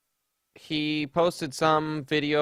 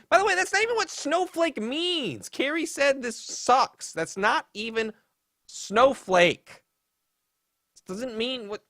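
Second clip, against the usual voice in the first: high exasperated rant; 11 semitones higher than usual. Real, not acted. Ranting in mock high voice.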